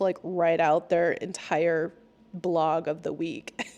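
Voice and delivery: ordinary delivery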